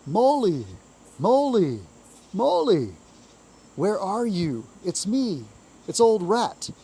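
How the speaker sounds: loud, high cheerful calls into the dark; cheerful calling out. A narrator's reading. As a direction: hail a lost friend brightly through the woods. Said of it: "calling out cheerfully".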